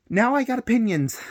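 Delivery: a loud, emphatic opener; emphatic declaration. Real, not acted. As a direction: announce it with a bang. Emphatic announcement of opinions.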